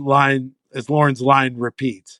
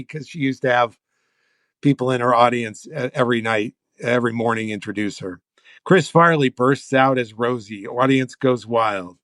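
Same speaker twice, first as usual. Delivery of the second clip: usual speaking voice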